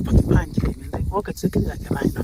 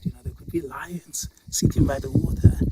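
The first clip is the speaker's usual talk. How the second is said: ordinary delivery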